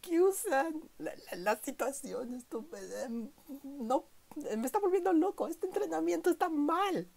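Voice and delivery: shrill mock scream of protest; mock yelling. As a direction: yell it high and desperate. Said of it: "y le grita".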